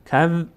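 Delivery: teacher demonstrating a vowelless ذ in "کذ"; vowelless consonant. Shown as a demonstration. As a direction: close "کذ" on a held ذ. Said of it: "به صورت ساکن".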